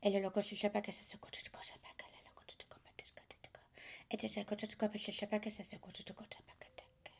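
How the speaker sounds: breathy unvoiced syllables, very soft; whispered light language. Channeler whispering light language to listeners, hushed.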